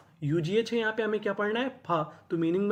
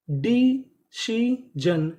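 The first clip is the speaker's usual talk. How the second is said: loud, very slow "decision" in three pieces; syllable by syllable; 3 semitones higher, 5 dB louder, much slower than usual. Shown as a demonstration. Teacher reads a new word to learners, slowly syllable by syllable. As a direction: stretch "decision" out, pausing after each syllable.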